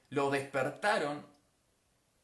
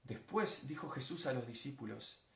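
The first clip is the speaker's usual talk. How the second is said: hushed calm; 2 semitones lower, 10 dB quieter than usual. Acted. Preacher describing the stilled sea, hushed calm. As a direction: settle into quiet, leaving room between phrases.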